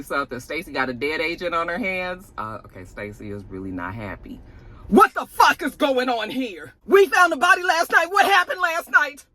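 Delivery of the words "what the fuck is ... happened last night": mock angry interrogation. Stacy grilling Vic about the dead agent, in a high, loud mock fury. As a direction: bark at him like a furious boss.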